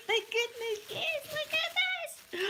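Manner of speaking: very high, loud delight; real high-pitched glee; squealing with joy. Real, not acted. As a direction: shriek over the gift. Opening favourite gift, squealing.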